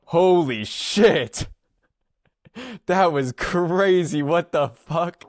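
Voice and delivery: loud, high, drawn-out exclamation as a quote; amazed outburst. Retold or imitated. Friend reacting to the speaker's boundaries, loud amazed outburst. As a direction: imitate a friend blown away, stretching the words out loud.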